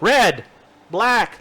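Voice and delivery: put-on loud, high kids-show call; announcer call. Shown as a demonstration. Host calling out golfer colours like a kids' show. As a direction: call out colours like Romper Room. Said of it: "I literally just have to say when the golfer comes up".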